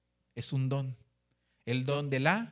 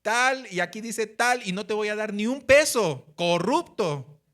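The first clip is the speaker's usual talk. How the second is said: loud, slow and higher, spitting out each word; indignant retort; 5 semitones higher, 6 dB louder than usual. Retold or imitated. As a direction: face down the corrupt cop with outrage. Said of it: "y le dije". (reclamo indignado, fuerte y lento, al oficial corrupto)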